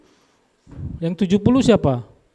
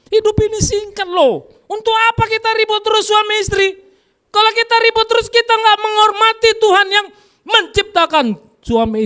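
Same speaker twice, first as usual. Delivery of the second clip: much higher, loud preaching cry; preaching shout; 13 semitones higher, 5 dB louder than usual. Real, not acted. Preacher crying out to the congregation, high and loud. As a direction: shout it out to the whole church.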